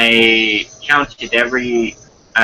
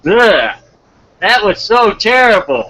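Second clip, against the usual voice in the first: tag; 10 semitones higher, 3 dB louder than usual. Real, not acted. Cringing at own botched take.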